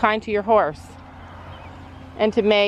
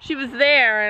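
ordinary talk, nothing marked

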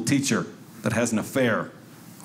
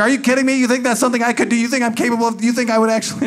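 mock outraged wife; 9 semitones higher, much faster than usual. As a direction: fire back offended and fast. Wife gets mad at him.

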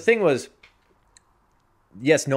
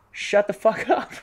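slow, high-pitched telling-off; mock exasperated retort; 11 semitones higher, 4 dB louder than usual. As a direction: draw it out as a comic put-down. Retelling own retort to the woman.

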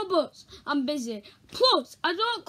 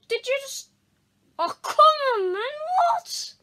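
outraged protest; 10 semitones higher, 6 dB louder than usual. Acted.

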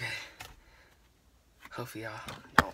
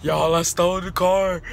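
louder, higher thrilled announcement; excited; 4 semitones higher, 8 dB louder than usual. Real, not acted. Vlogger bragging to viewers about the stolen car, excited. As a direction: brag it to the viewers, hyped. Thrilled after driving away.

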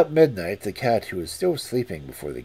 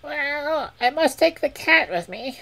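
very high bird voice; falcon voice; 5 dB louder than usual. Acted. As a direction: chirp it out like a little bird. Falcon speaks.

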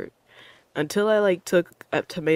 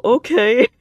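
usual speaking voice

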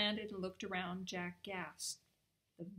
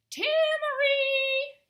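very high, long-drawn holler; stretched sing-out yell to someone far away; full shout; 16 dB louder, much slower than usual. A narrator's reading. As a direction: call out as loudly as possible. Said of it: "he shouted as loudly as he could".

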